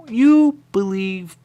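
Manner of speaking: very high, loud and slow; drawn-out declaration. Retold or imitated. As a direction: stretch it out dramatically. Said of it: "it was really quick".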